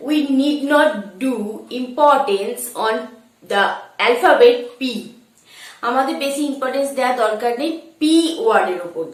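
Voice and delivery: ordinary talk, nothing marked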